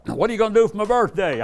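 high, loud, quick wife's question; voicing the wife. Wife greeting him at the airport, high and eager.

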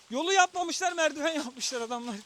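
high disbelieving exclaim; can't believe the stairs; incredulous. Real, not acted.

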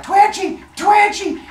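high, loud squeal; Arachne. Acted. Arachne being beaten with the loom, squealing high and loud. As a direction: cry out shrilly under the blows. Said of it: "and Arachne's like".

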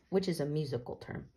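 plain reading voice

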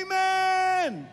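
loud, high amen; shouted amen. Shown as a demonstration. Pastor leading the room in a shouted amen. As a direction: call it out big. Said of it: "One more time, say".

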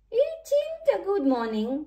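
high-pitched moan. A narrator's reading. Diesel engine answers Flynn's morning greeting, moaning. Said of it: "moaned Diesel".

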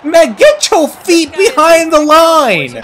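high, slow, laughing outrage; half laugh, half shout at the screen; outraged laughing yell. Real, not acted. Angry at a missed three.

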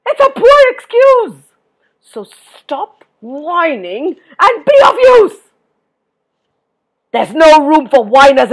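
angry yelling, slow and high; Tuffy. A narrator's reading. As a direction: bellow a scolding, drawing out the words. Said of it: "yelled Tuffy".

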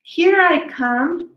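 ordinary delivery, nothing marked

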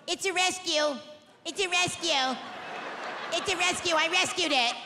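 lightly mocking posh dog owner, smug and repetitive; a hint of a smug rich LA dog owner; snooty rich dog owners. Mocking skinny rich people bragging about rescue dogs, smug. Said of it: "They're all".

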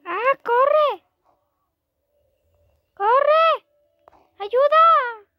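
high frightened yell; panicked shout. Pretend. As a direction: scream for help, running. Fleeing Piggy, panicked. (grito de pánico)

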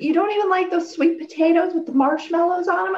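high incredulous mock mother voice; hammed-up disbelieving mom; imitating Mom. Sister mimics Mom asking about sweet potatoes, high disbelief. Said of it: "And she's like".

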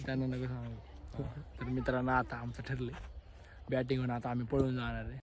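plotting in a hushed voice. Real, not acted. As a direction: keep it low so the others won't hear. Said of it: "हळू बोल, हळू बोल".

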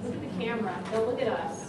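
very soft, low aside; hushed prompt. Real, not acted. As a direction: slip them a quiet stage direction.

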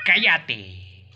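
sudden loud order to be silent; irritated outburst. Real, not acted. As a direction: call out in annoyance. Snaps at a noise in game.

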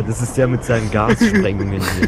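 high and loud with laughter; laughing. Real, not acted. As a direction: talk through laughing. Laughing at gas blasts.

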